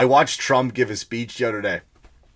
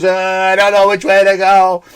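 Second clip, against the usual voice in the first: higher, louder befuddled imitation; mock confusion; 5 semitones higher, 5 dB louder than usual. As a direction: imitate someone loudly lost, hammed-up. Mimicking a speaker lost on stage.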